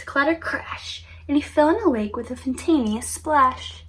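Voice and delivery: put-on lively storybook narration for children; storyteller reading a picture book aloud; bedtime story reading. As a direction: read it like a bedtime tale, full of rhyme.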